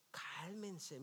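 very soft, gentle calm-down. Acted. Jesus soothing his scared disciples, very softly. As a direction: hush them gently.

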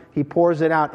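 normal talking voice

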